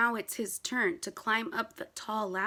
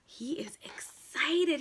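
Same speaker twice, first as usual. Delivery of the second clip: excited whisper; 3 dB quieter, slower than usual. Acted. Whispered excitement after gasp.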